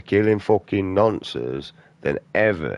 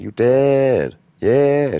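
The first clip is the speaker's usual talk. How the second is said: slow, higher, louder mock taunt; 8 semitones higher, 5 dB louder, much slower than usual. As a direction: goad him, drawn out. Taunting the imagined offender.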